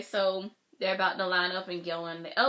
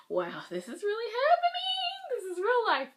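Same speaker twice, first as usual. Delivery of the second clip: squealed disbelief. Retold or imitated. Fan reliving waiting for the group, squealed disbelief. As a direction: let the voice soar in amazement.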